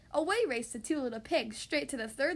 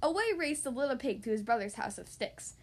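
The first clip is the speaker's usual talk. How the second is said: ordinary delivery, nothing marked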